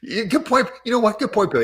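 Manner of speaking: high, loud mock enthusiasm; excited. Real, not acted. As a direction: play up the excitement.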